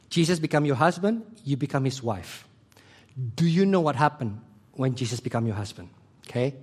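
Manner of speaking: usual speaking voice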